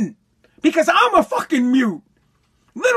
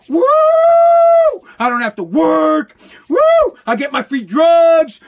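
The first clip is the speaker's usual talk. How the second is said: hammed-up whooping, louder and higher with every Woo; mock cheering; 7 semitones higher, 7 dB louder than usual. Podcaster mocks welfare recipients celebrating, hammed-up loud whooping. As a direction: cheer like a crowd that just won free stuff.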